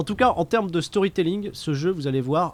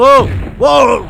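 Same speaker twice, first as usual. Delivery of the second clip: surprised shout; 8 semitones higher, 14 dB louder than usual. Real, not acted. Reacting to a big hit.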